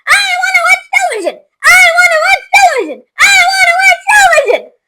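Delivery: high, fast squeaky shrieks; screaming tantrum. A narrator's reading. Shrunken boy screams in a tantrum, squeaking. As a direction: yell it over and over. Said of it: "screaming and yelling".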